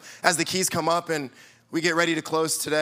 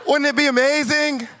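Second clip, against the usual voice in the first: gushing; 6 semitones higher than usual. Real, not acted. Dreamy wish for easy life.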